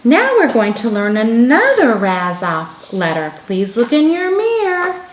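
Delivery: put-on loud, bright teacher voice for a child; teacher script voice. Shown as a demonstration. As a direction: announce the next letter to a pupil.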